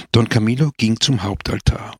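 ordinary delivery

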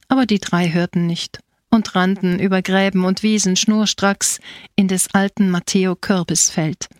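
usual speaking voice

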